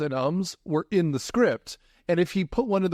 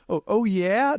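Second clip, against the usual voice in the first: attempted Minnesota accent; 6 semitones higher, 3 dB louder, slower than usual. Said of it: "That was so close".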